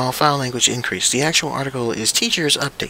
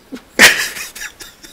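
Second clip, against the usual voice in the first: loud laugh; 7 dB louder than usual. Real, not acted. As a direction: burst of disbelieving laughter. Laughs at absurdity.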